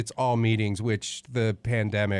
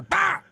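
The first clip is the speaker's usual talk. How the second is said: monster bark. Retold or imitated. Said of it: "monster guy".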